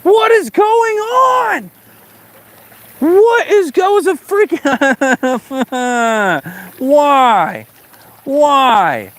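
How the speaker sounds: very high, slow, laughing why; can't believe the rod bend got away; frantic disbelief. Real, not acted. Fish escaped, laughing disbelief.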